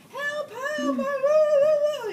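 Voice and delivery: high, loud panicked cries for help, slowing down; retold hysterical screaming. Nine-year-old fleeing into the neighbor's house, screaming for help. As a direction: shriek help like a terrified kid. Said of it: "And I'm screaming".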